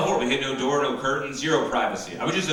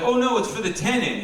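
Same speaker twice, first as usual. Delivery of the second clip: put-on innocent kid; 10 semitones higher than usual. High cheerful kid voice.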